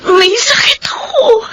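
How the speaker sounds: tearful confession, breaking into a cry. Acted. Girlfriend confesses her illness, sobbing.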